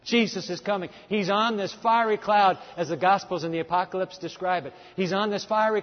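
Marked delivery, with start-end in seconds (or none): none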